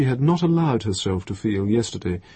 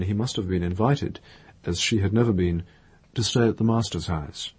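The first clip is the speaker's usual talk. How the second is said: ordinary delivery, nothing marked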